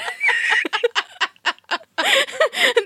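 breathless high giggles; high giggling fit. Real, not acted. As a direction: giggle helplessly after the fright. Laughing after jump scare.

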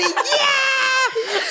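very high laughing yell; high shrieking yell; coaster scream. Retold or imitated. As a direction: shriek it like falling. Screaming on the drop.

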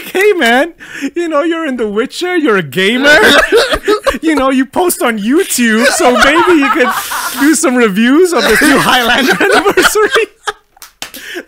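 high, slow wheedling pitch through laughter; mock studio pitch. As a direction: coax him like a studio rep, cracking up. Acting out studio's pitch to Cavill.

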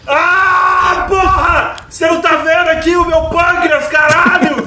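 loud, high agonized yelling; screaming in pain. Acted. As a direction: shout it while being torn apart. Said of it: "bota alguém gritando violentamente de dor".

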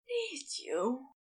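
hushed, needy groan. Acted. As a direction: groan it out softly. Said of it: "Aziraphale groaned".

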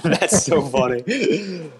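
high, slow, through real laughter; laughing. Real, not acted. Host cracking up at a friend's quote research, laughing. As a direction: barely get it out while cracking up.

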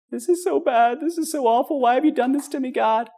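high, whiny cry of self-pity; mock despairing lament. Preacher parodies how Stephen did not complain, in a high whine. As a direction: overplay a man wailing at God.

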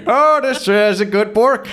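high, loud hammed-up Boston; mock Boston accent. Co-host joins the bark joke, mock Boston accent. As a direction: cry out in exaggerated Boston, "bork" for bark. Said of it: "how they would say it in Boston".

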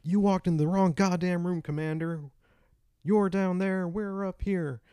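high, loud taunting yell; shouting. Retold or imitated. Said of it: "shouting at each other".

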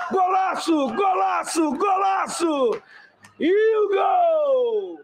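high, slow, shouted goal call; explosive goal commentary. Acted. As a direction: scream the goal like a TV narrator. Goal scored, commentator erupts.

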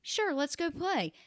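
put-on high, loud kid making a friend; eager children. One child asking another to play, eager. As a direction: pipe up like a keen child.

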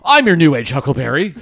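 loud drawled gunslinger line; a cocky cowboy boast; Doc Holliday voice. Pretend. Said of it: "he'll say".

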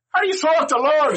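high, loud baffled priest. Retold or imitated. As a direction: ask in disbelief as the priest. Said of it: "He goes".

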